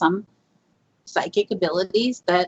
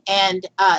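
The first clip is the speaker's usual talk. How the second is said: ordinary talk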